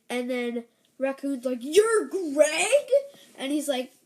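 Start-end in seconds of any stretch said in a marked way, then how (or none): 1.6-2.9 higher, louder incredulous question; astonished raccoon. Acted. Raccoon recognising the scientist as Greg, loud surprise. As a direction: exclaim it in mock disbelief, rising at the end.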